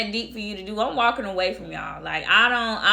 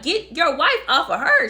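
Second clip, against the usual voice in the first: louder, higher imitation of an angry order; raised-voice mock command; retold urgent demand; 7 semitones higher, 5 dB louder than usual. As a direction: act out yelling at someone to intervene. Boyfriend's retold demand during the fight.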